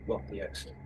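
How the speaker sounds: hushed, very soft aside; whisper. Acted. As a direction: whisper a discreet order to a companion. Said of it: "I'm going to whisper to Cassius".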